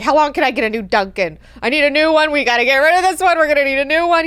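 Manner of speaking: loud, fast, high mock tirade; hammed-up impatient boss; angry demand. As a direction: demand a replacement, impatient and worked up. Angry lord demands a replacement.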